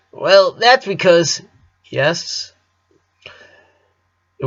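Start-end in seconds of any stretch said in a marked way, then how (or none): 0.1-2.5 stalling. A narrator's reading. Lynn stalling, irritated.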